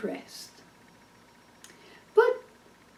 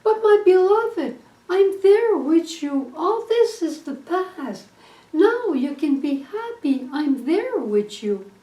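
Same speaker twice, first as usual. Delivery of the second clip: higher and louder, gently exasperated; a little upset; 5 semitones higher, 6 dB louder than usual. Acted. Husband to his wife who keeps complaining, mildly upset and raised. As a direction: press her to let the past go. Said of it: "a little upset".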